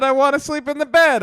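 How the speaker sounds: put-on high little-girl voice; mock toddler pleading; imitating the child. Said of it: "She said".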